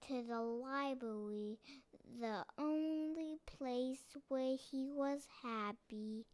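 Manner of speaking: ordinary delivery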